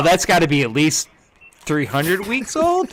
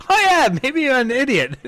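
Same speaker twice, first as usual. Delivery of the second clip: playing dumb; 9 semitones higher than usual. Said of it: "I was like".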